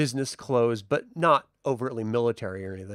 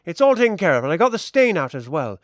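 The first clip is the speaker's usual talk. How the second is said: put-on brisk, raised old-lady voice; fussy seamstress; 4 semitones higher, 5 dB louder, faster than usual. Seamstress reassuring the admiral, brisk and raised. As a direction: rattle off the reassurance quickly. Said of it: "She's like".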